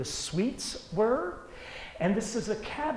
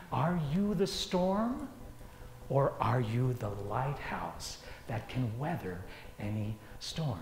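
soft and sincere; 4 semitones lower, 6 dB quieter than usual. Acted. Inspirational rhetorical question.

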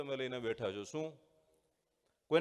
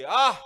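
sharp loud cry of pain, higher; a boy yelps after tripping on a stone; scream of pain; 7 semitones higher, 16 dB louder than usual. A narrator's reading. Boy falling on the mountain path, loud pained scream. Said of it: "એને ચીસ પડી ગઈ".

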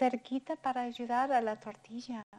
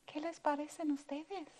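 usual speaking voice

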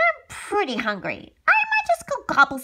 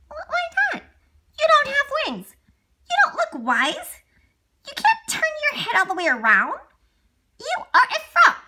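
put-on high, whiny kid frog; little frog child; 13 semitones higher, 6 dB louder than usual. Son frog whines to Daddy in a high child voice. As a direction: pipe up like a small frog child.

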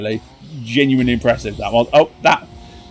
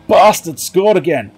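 usual speaking voice

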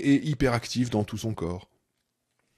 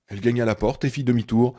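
usual speaking voice

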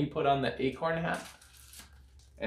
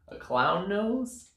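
plain reading voice

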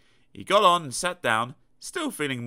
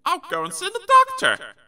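small high character voice; 6 dB louder than usual. Acted.

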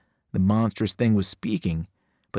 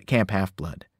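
ordinary delivery

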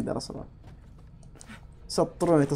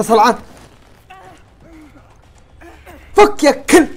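loud, higher angry shouts at the screen; furious yelling; 9 semitones higher, 9 dB louder than usual. Real, not acted. Gamer cursing the monsters attacking him, in a real furious shout. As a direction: rage at the monsters, peaking into a scream.

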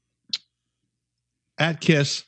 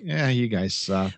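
dismissive coworker; 5 semitones lower, 5 dB quieter, slower than usual. Retold or imitated. Mimics apathetic coworkers' dismissal.

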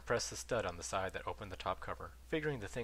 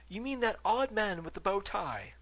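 lightly voiced ghost; 12 semitones higher, 6 dB louder than usual. Ghost asks.

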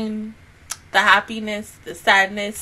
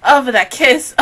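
usual speaking voice

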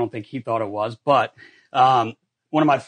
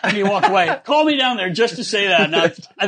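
ordinary delivery

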